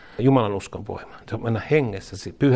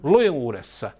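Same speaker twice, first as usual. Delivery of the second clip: leading a prayer; 6 semitones higher than usual. Acted. Preacher leading the church in a repeated prayer, fervent call. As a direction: call each line out to be repeated.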